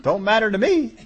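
breezy, unbothered God voice rising to loud, laughing by the end; preacher voicing a carefree God to the congregation; God speaking, offhand. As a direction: play God shrugging it off, chuckling. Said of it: "God said".

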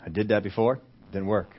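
low, soft justification; subdued excuse. Acted. Hurt churchgoer explaining staying away, low and subdued. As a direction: mumble the excuse, voice dropped. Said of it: "and what do they say".

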